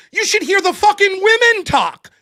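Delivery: ordinary talk, nothing marked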